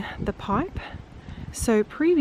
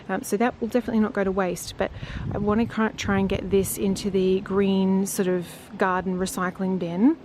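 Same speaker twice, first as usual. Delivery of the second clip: ordinary talk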